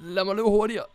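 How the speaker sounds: high, urgent repeated begging; panicked pleading. Real, not acted. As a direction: plead frantically to be let run. Pleading to run from werewolf.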